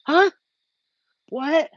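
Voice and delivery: high-pitched, puzzled act; feigned cluelessness. Acted. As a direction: play someone pretending not to understand. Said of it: "act like they don't know".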